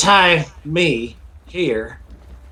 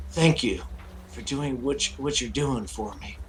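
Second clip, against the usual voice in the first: quiet; 3 semitones lower, 11 dB quieter than usual. Acted. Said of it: "he's gonna turn to Jarrell and say".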